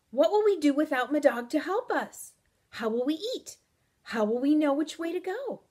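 distressed at being left without help; alarmed. Acted. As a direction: fire off worried questions, voice lifted. Panic at losing the guide.

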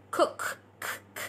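"Cook" ending in a crisp K, then bare "K, k"; released K sound. Shown as a demonstration. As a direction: pop the final K of "Cook", repeat it voiceless and soft. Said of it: "Say the K sound".

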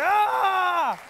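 put-on high, loud, drawn-out child's wail; long mock scream. Acted child, language not as advertised.

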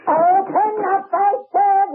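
high, insistent shout; insist, annoyed, through the door; landlady losing patience at the door; impatient demand. Acted.

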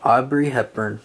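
ordinary delivery

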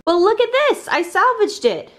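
real delighted triumph; proud reveal. Real, not acted. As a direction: show off the saved cake.

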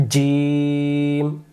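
জিম with a hard j and a long held vowel; firm articulation. Shown as a demonstration. Teacher models the letter from mid-tongue, জিম firm and drawn out. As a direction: press mid-tongue to palate and stretch জিম slowly. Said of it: "শক্ত করে জিহ্বার মাঝখান থেকে".

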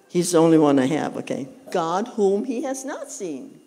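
normal talking voice

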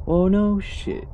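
high, loud outburst; loud exclamation. Real, not acted. Gamer reacts to the shattered item, exclaiming. Reacting to on-screen game text.

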